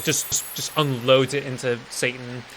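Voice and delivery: ordinary talk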